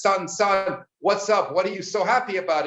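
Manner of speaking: a dad bewildered at his gleeful son; puzzled father. Acted.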